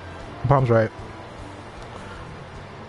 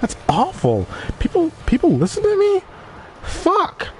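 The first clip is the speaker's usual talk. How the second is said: shocked disbelief; 10 semitones higher than usual. Retold or imitated. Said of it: "I'm like".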